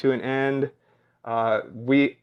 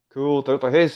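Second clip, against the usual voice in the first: ordinary talk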